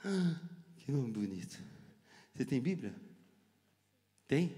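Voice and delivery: ordinary talk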